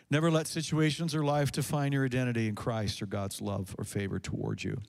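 ordinary talk, nothing marked

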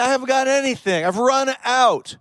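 high-pitched plea of having nothing; hammed-up helpless widow; acted desperation. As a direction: plead it high, drawing out the final words. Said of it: "It felt like there was just".

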